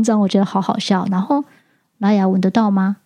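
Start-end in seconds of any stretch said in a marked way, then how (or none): none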